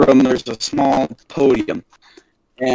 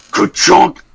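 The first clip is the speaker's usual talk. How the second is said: loud mimicked clunk of a heavy door; voiceless door-slam sound effect; imitated door clunk; 3 dB louder than usual. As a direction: make a slow, forceful ka-chunk noise.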